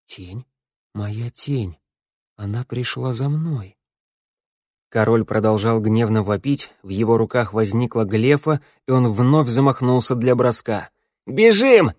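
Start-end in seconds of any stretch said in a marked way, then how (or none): 0.0-3.8 much softer, fearful hush; frightened whisper. A narrator's reading. Teo terrified whisper. 11.2-11.9 high, loud urgent yell. A narrator's reading. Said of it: "завопила Шныряла".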